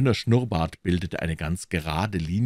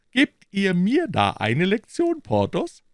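higher and slower, bristling; sharp retort; 13 semitones higher, 5 dB louder, slower than usual. A narrator's reading. Aramis bristles when Porthos lectures him, sharp and slow. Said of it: "rief Aramis".

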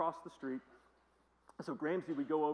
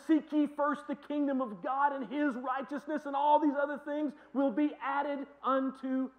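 scripture read aloud; 9 semitones higher, 7 dB louder than usual. Real, not acted.

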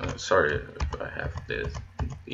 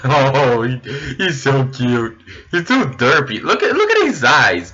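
excited; 6 semitones higher, 5 dB louder than usual. Real, not acted. Gushing over llama.

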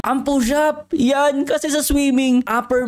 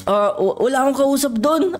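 ordinary delivery

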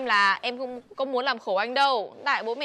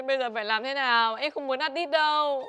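ordinary talk, nothing marked

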